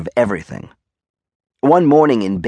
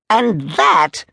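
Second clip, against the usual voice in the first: high, sharp demand; indignant Major; 9 semitones higher than usual. Acted. Major pointing at soldier's slippers, high and indignant.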